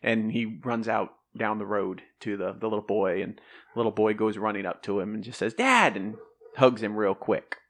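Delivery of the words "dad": put-on excited child. Boy runs to his father.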